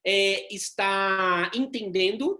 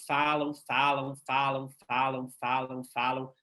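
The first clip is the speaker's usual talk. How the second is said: droning repetition; 6 semitones lower, slower than usual. Shown as a demonstration. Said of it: "pessoas que são prolixas".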